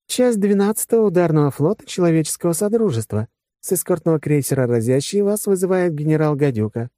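high-pitched ship computer voice; virtual assistant reporting. Acted. Virtual Vasya reports the incoming fleet to his commander, formally.

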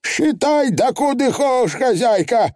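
high, bold Feegle declaration; high triumphant pixie; defiant Feegle. Acted. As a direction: throw down the pencil proudly. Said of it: "заявил Фигли".

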